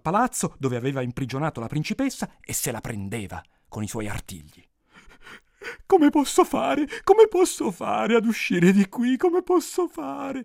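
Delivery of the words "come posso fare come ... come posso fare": high, slow princess's wail; hammed-up wailing plea; imploring lament. A narrator's reading. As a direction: plead and moan, loud and drawn out. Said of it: "si lamentava la principessa implorando l'orco".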